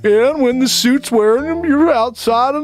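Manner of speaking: TV therapist parody, rising as it goes; Doctor Phil. Acted. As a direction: ramble tongue-twisters as a celebrity therapist. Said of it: "Yeah, yeah, Doctor Phil".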